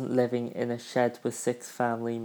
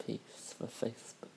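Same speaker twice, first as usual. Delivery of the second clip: whispered aside; 15 dB quieter than usual. Real, not acted. Quiet aside to himself.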